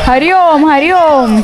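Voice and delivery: loud, high called greeting; calling out greeting. Real, not acted. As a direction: call it out across the yard. Greeting family on arrival.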